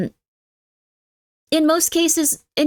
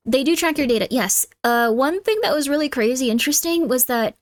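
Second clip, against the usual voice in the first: ordinary talk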